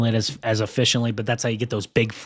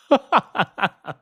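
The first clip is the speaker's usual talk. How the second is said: burst of laughter; 10 semitones higher, 7 dB louder than usual. Real, not acted. Laughs at the forecast.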